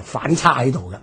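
ordinary talk

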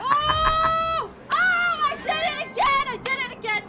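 high frustrated shouting; raging gamer. Real, not acted. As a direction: scream it out, fed up. Dying again in the game.

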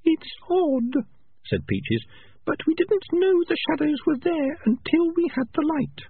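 put-on high, earnest young female rat; Peaches' voice. A narrator's reading. Peaches telling the clan leader, high young rat voice. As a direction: pitch it up for the clever young rat.

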